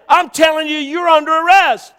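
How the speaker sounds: loud, high shouted arrest; retold officer's shout. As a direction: bellow the order as the exasperated officer.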